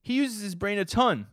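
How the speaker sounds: put-on a flustered boy hero sticking up for himself; Denji defending himself. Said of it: "but I'll be Denji".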